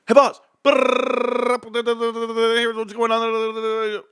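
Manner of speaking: high-pitched mock staff clamour; employees pouncing on the boss. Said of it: "everybody walk up to me and go".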